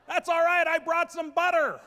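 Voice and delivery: loud, high triumphant announcement; proud punchline. Retold or imitated. Husband proudly telling the doctor about butter, loud. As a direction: deliver it like the hero with the answer.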